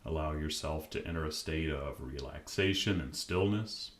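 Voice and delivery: calm, unhurried meditation voice with long pauses; guided meditation. Acted. Podcast host guiding listeners to relax, calm meditation voice. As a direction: ease the listener gently into stillness.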